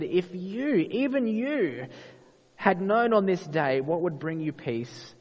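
slow lament, lifting high on the address; mild retold lament. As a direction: draw it out as a pleading lament, without tears. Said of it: "he wept over it".

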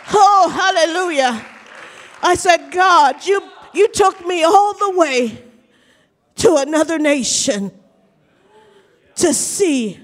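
high, exultant hallelujah preaching; fervent preaching. Acted. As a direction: build to loud praise, drawing words out. Preaches at full force.

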